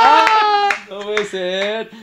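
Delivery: high, slow delighted disbelief; gasping at an unexpected gift; surprised. Real, not acted. Receiving a gift, amazed.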